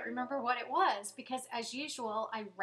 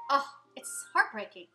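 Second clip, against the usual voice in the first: normal talking voice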